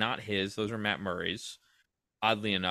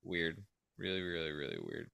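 trailing off; 7 semitones lower, 10 dB quieter than usual. Real, not acted. Muttering while watching replay.

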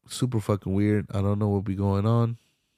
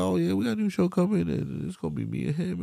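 high, fast, breezy mock promo voice; lightly voiced carefree daughter hyping a show; imitating the daughter; 9 semitones higher, 3 dB quieter, faster than usual. Imitating the daughter hyping her show, high and fast. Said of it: "that's how she was talking".

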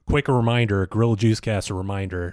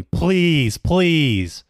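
higher, louder mock whine; whiny begging; 7 semitones higher, 4 dB louder than usual. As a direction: beg like a spoiled child.

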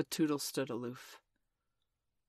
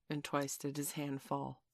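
plain reading voice

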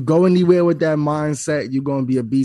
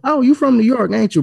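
retold guess, pitched much higher; imitating curious Southerners; 10 semitones higher than usual. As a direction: play the nosy local in a high voice. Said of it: "everybody having that Southern accent".

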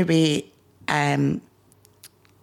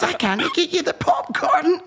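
giggling through the line; laughing; 13 semitones higher than usual. Real, not acted. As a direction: quote broken up by real laughter. Laughs mid retelling.

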